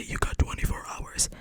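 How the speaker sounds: hushed mimicry of a coming line; quietly quoting ahead; whispered prediction. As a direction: whisper it as a knowing preview. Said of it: "He's gonna say".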